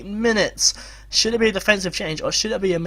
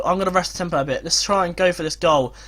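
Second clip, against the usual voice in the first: ordinary talk, nothing marked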